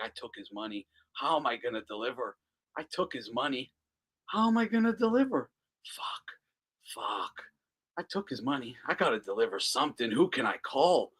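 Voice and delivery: mock Dana fretting to himself, half whispered; nervous muttering. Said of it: "He's like".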